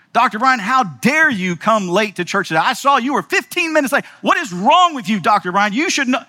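high, loud mock berating of a latecomer; abusive preacher. Pastor publicly berating a late churchgoer, high and loud. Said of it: "Verbally abusing them".

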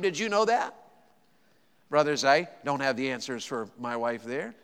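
normal talking voice

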